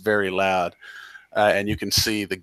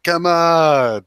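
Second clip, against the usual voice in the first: shout; 9 semitones higher, 7 dB louder than usual. Acted. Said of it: "and he's like".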